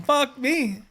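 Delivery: high, loud, exaggerated "Fuck me"; hammed-up exasperation. Mocking his own reaction to an angry driver, hammed-up. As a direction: ham up the groan of disbelief.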